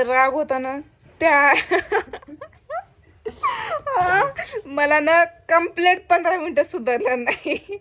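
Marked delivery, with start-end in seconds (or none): 3.2-4.6 pained laughing cry. Real, not acted. Describing nail pain.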